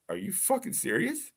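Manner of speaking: high, softened incredulous question. Retold or imitated. Caller reacting to dispatcher's refusal, incredulous. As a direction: pitch climbs in retold disbelief.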